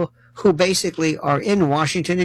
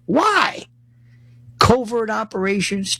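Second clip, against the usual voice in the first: ordinary delivery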